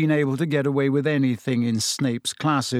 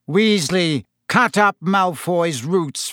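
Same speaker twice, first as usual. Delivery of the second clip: sharp name, slow order; curt order; 5 semitones higher, 4 dB louder, slower than usual. A narrator's reading. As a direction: bark it without looking up. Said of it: "without looking up".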